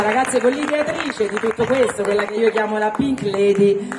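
normal talking voice

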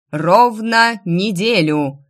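slow loud ominous call; town crier. Acted. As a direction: draw it out to build tension. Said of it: "продолжал нагнетать обстановку глашатай".

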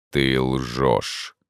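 low, cool, unbothered; cold-blooded. A narrator's reading. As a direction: state it with icy calm. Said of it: "хладнокровно отвечал призрак". (хладнокровно)

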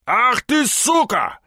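high-pitched burst of rage; angry outburst. A narrator's reading. Gangster jumping up to attack the policeman, cursing angrily. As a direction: curse him with rising fury.

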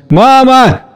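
high, drawn-out summons; calling out. A narrator's reading. Anya's voice summoning her mother, calling out. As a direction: call for her from afar. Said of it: "Голос Ани".

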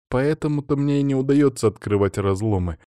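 ordinary delivery, nothing marked

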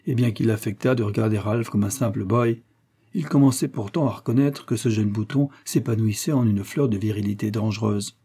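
ordinary delivery, nothing marked

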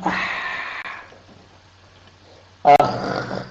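أح and أخ pushed out on breath alone with no voice; voiceless أح, أخ with audible breath; breathy letter demo. Shown as a demonstration. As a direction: let only air hiss through on أح then أخ. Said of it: "لاحظ".

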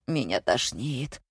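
low, slow, soft moan; weakly voiced sick moan; nauseous groan. A narrator's reading. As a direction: groan it out as if queasy. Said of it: "простонал Григорий".

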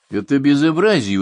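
loud, high protest; indignant. A narrator's reading. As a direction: burst out in outrage. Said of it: "возмутился Анчелли". (возмущённо)

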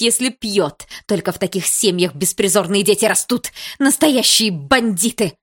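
loud, high-pitched furious tirade; outraged mother in full cry; enraged. A narrator's reading. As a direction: rant with mounting contempt, hammering the insults. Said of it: "а Есина разъярилась".

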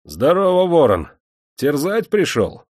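high, loud, playful defiance; a chained prisoner mocks his master cheekily; cheeky reply. A narrator's reading. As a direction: toss back a saucy greeting. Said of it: "озорно отозвался".

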